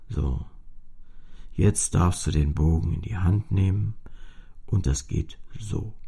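a low, lightly voiced talking cello; cello speaking. A narrator's reading. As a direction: voice the old cello calmly instructing.

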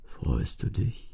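leise, langsam, tiefer gehaucht; sehr sanft. A narrator's reading. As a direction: zart nachfragen. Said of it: "Und dann sehr sanft". (sehr sanft und leise)

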